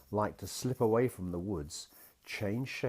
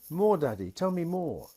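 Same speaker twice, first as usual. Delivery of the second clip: put-on high, eager child asking; a small girl's bright question; little girl voice; 11 semitones higher, 5 dB louder than usual. A narrator's reading. Daughter asks her daddy in a high, eager little voice. Child begs for more story.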